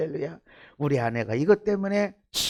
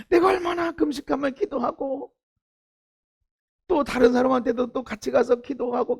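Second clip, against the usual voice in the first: ordinary talk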